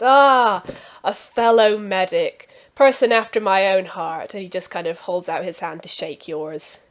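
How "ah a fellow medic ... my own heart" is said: delighted doctor. Acted. NPC doctor greets fellow medic.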